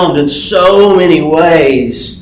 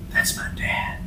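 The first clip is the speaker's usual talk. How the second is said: breathless hushed whisper from behind; 16 dB quieter than usual. Retold or imitated. Daughter whispering to her father behind the guy. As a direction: whisper it so only dad hears. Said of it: "I hear her from behind him whisper".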